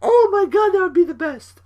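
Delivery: over-the-moon laughing glee; giddy. Real, not acted. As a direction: squeal with delight. Giddy over his own bit.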